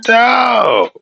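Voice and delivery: loud, high disbelieving yell; reacting loudly to the robot-voiced caller; incredulous shout.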